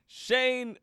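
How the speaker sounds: loud, high announcer-style shout; hyped guest intro. Real, not acted. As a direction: whoop the guest into the room, breaking into laughter.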